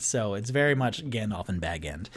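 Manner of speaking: ordinary talk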